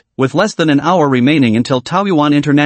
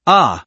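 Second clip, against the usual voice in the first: plain reading voice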